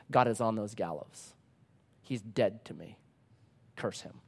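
soft, low and falling away; grave inner voice. Retold or imitated. Elie's heart answers at the gallows, grave and hushed. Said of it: "in my heart a voice spoke".

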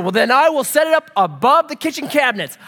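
mock-outraged comeback; exasperated dad. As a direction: act out a parent losing the argument loudly. Retold escalating argument with daughter.

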